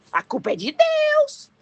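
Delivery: accusing cry. Acted. People blaming God when the worst happens, high accusing cry. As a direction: throw the blame upward, exaggerated.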